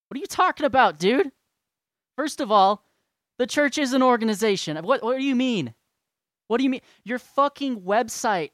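high, loud, disbelieving outburst; can't believe what he's reading, let it rip; incredulous outrage. Real, not acted. Outraged reply to a hostile DM.

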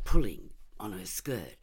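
plain reading voice

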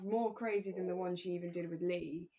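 normal talking voice

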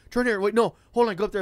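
high, loud, scattered directions; imitated flustered rider. Rider directing driver to the side street, mock flustered. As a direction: mimic a passenger changing his mind mid-turn.

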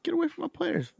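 very high, thin mock yell; squeaky mock coach. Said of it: "he said".

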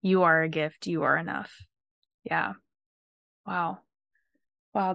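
normal talking voice

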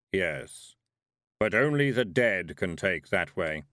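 slow, heavy voice of Death; heavy thundering voice. A narrator's reading. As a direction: let it rumble like distant thunder. Death answers.

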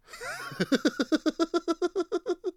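long, high cackle; cracking up. Real, not acted. Cracking up after his own Top Boy bit, high laughter. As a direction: burst into high, helpless laughter.

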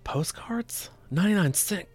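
high, shocked squeak at the prices; scandalized ghost. Pretend. Ghost outraged at gift shop prices, squeaking high.

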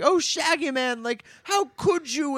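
high, dismayed reproach; reproachful Dorothy. Retold or imitated. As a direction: scold in a high voice. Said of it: "Dorothy's like".

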